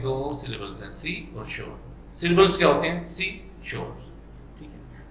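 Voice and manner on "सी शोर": "सी, शोर" in two separate beats; split into syllables. Shown as a demonstration. Teacher counting syllables for students, split into two beats. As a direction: pause clearly between सी and शोर. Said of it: "दो झटकों में ये".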